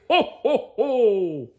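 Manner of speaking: high cartoon jolly laugh; jolly Santa ho ho ho. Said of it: "heard him yell".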